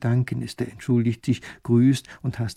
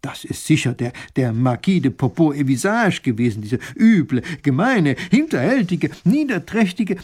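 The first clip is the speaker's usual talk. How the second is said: rising, louder tirade, pitch climbing; furious; 4 semitones higher, 6 dB louder than usual. Acted. Grand duke raging about the Marquis, furious. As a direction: spit out each insult higher and louder. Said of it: "wird so wütend".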